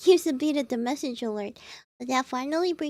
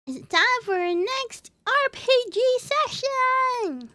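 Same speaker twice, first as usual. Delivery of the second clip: high, slow, louder hype call; excited announcement; 9 semitones higher, 3 dB louder than usual. Real, not acted. Streamer hyping chat for the next RPG session, high and slow. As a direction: announce the session with a big lift. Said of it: "announcing the session".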